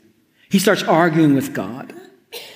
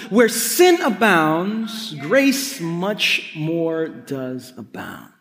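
slow scripture quote; much slower than usual. Acted. Preacher quotes scripture climax.

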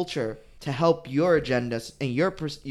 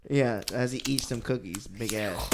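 ordinary talk

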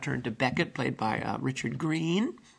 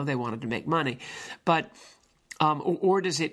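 ordinary delivery

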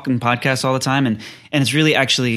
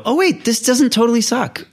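much higher, surprised relief; relieved surprise; 10 semitones higher than usual. Retold or imitated. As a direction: gasp out the relief that it works. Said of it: "And then you go".

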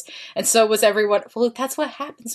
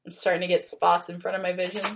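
winded from laughing; breathless, fading voice; out of breath; 3 semitones lower, 6 dB quieter than usual. Real, not acted. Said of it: "I can't breathe".

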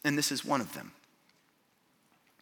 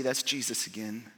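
ordinary talk

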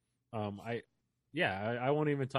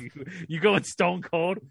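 loud, high wrestler growl; Stone Cold voice; 6 semitones higher, 7 dB louder, slower than usual. Pretend. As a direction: yell it like a wrestling promo. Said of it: "I don't have a wrestler voice".

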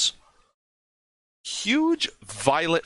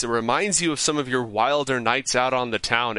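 ordinary talk

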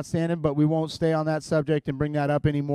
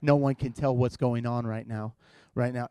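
mock hushed reply; 8 semitones lower, 7 dB quieter than usual. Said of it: "I'm like".

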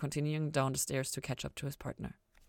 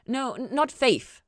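sharp, louder, higher correction; sharply; 9 semitones higher, 7 dB louder, slower than usual. A narrator's reading. Chloe sharply correcting Ella, firm louder insistence. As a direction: cut her off firmly. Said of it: "Chloe said sharply".

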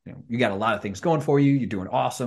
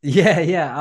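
bright laughing yes, higher and louder; laughing yeah; 7 semitones higher, 8 dB louder than usual. Real, not acted. Host laughing at his guest's joke, laughing.

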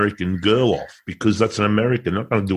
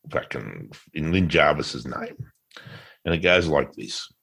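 ordinary talk

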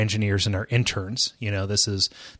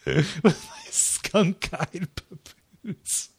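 laughing while talking; 5 semitones higher, 6 dB quieter, much slower than usual. Real, not acted. Echoing with a slow laugh.

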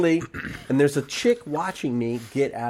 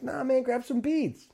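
high, slow, laid-back invite; imitated relaxed float rider; easygoing welcome; 11 semitones higher, 5 dB quieter than usual. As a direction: wave him aboard, stretching the words. Float man welcomes him aboard.

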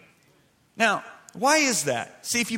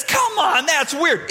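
scoffing skeptic; 8 semitones higher, 3 dB louder, slower than usual. Retold or imitated. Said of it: "Now somebody says".

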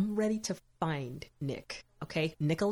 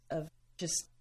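normal talking voice

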